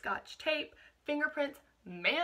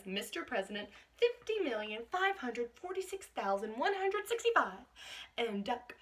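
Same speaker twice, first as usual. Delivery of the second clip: ordinary delivery, nothing marked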